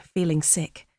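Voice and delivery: usual speaking voice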